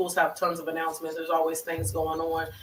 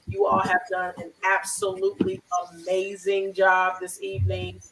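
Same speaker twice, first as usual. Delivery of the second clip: ordinary talk, nothing marked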